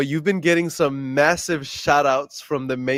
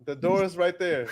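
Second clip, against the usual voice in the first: laughing; 2 semitones higher than usual. Pretend. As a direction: joke while cracking up. Joking dismissal with laughter.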